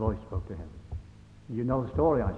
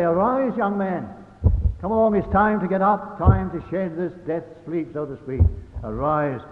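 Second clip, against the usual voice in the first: loud, higher command to rise; commanding call; 4 semitones higher, 6 dB louder than usual. Acted. Jesus calling the young man up from death, loud command. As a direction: rouse a dead man with a command. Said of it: "he'd say".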